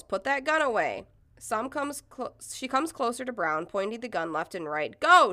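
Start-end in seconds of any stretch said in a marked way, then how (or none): 0.0-1.1 higher-pitched mild yell. Retold or imitated. Protester to woman with gun, yelling mildly. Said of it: "Someone else yells". 5.0-5.3 loud, high one-word yell. Retold or imitated. Woman with gun to protester, yelling. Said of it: "she yells".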